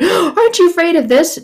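high, fretful imitation of people; mock worried question. People asking her about news, mock fretful and high. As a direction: parody an anxious questioner. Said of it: "You talk to people that".

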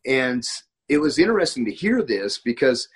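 ordinary delivery

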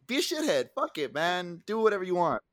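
high, sarcastic voice of careless bosses; mocking the superiors. Friend mocking the superiors who encouraged drinking, high and sarcastic. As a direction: lampoon the bosses' hypocrisy.

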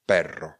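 "Perro" with a growled, trilled RR; rolled double R. Shown as a demonstration. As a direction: roll out the RR in "Perro". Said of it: "perro s dvěma R".

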